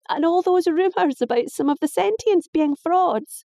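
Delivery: high, exasperated protest; indignant girl. Acted. Daughter voiced, high.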